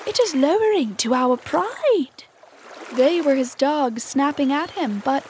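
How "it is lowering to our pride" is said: higher-pitched pirate complaint; aggrieved crewman. A narrator's reading. Crew snap at their captain, high aggrieved complaint. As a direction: complain with voice swooping up.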